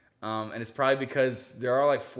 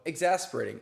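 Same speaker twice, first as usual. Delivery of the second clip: ordinary talk, nothing marked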